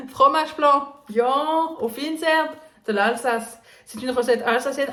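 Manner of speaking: high, laughing mock Alsatian accent. Shown as a demonstration. Reading an Alsatian recipe to viewers in a mock Alsatian accent. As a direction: play up an Alsatian accent while naming the dish. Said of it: "quand je fais l'accent".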